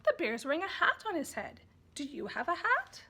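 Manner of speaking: put-on high, bright baby talk; parent reading to baby. Shown as a demonstration. Parent demonstrating to baby, in sing-song baby talk. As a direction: show how you'd talk to a baby about the hat. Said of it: "And you can say".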